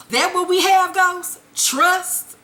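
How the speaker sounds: high, incredulous questioning; flabbergasted. Retold or imitated. Tasha questioning Ghost's talk of trust, flabbergasted. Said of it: "Tasha is just fucking flabbergasted".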